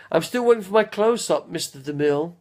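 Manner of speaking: Sunset Boulevard quote. Retold or imitated. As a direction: camp it up like an old screen star. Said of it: "Quite from Sunset Boulevard".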